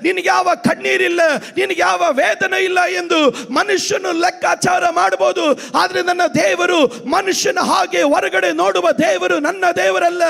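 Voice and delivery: high, driving sermon shout; full-force preaching. Real, not acted. Pastor to weeping mothers at morning worship, full-force preaching. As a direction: preach with fervour, stretching the final cry.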